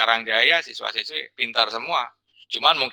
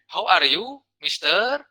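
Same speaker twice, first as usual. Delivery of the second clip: higher-pitched Sundanese-accented English; 6 semitones higher, slower than usual. Shown as a demonstration. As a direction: demonstrate English spoken with a Sundanese lilt. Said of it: "tapi logatna logat Sunda".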